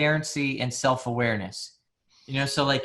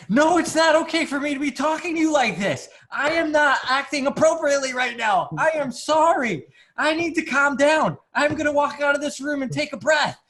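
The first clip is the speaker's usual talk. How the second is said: much higher mock-earnest voice, slowed on I am sorry; hammed-up self calming down; 10 semitones higher than usual. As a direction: play your past self overly calm and contrite, pitched way up. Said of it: "And I'm like".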